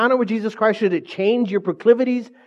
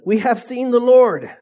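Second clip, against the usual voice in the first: excited announcement; 6 semitones higher, 6 dB louder than usual. Retold or imitated.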